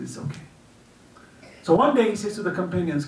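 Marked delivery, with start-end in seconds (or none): 0.0-0.5 much softer, calm and forgiving; gentle reassurance. Acted. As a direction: say it softly, letting it go. Imam answers the curser calmly.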